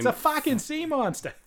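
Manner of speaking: put-on very high Boston-accented exclamation; Boston accent outcry. Interrupting with a Boston catchphrase, high mock-alarmed Boston accent. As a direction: squawk it with Boston R's dropped. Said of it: "if you're in Boston".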